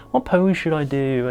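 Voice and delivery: slow, unsure mock question; timid. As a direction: imitate an awkward photographer dithering. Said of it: "being quite timid".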